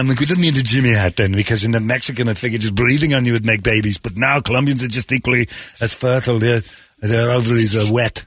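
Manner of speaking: comic Arnold impression, Austrian accent; Arnold Schwarzenegger Austrian accent. Former governor tells of California, Arnold-style Austrian accent. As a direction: ramble on in a put-on Arnold accent. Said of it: "have to do the accent".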